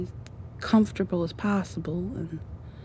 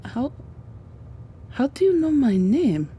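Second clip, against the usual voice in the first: startled question; 6 semitones higher than usual. Acted. Acted shock at being recognised.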